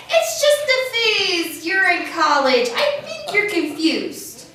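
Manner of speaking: loud, high, drawn-out mockery; high, loud imitation of naysayers; dismissive mock quote. Poem voices dismissive people's lines.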